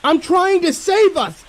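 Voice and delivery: very high, slow, piercing screech. Acted. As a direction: screech it right into his face. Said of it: "I screech into his face".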